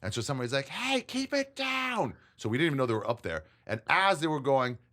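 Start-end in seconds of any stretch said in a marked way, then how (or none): 0.7-2.1 very high, slow whiny call; mock neighbour. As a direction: stretch it out like a fed-up neighbour. Neighbour yells down retold.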